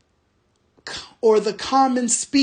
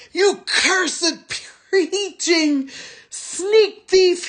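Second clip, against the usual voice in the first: fear and rage yell; 8 semitones higher, slower than usual. A narrator's reading. Tracker yelling at the soldier in fear and rage. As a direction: scream in fear and fury. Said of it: "he yelled".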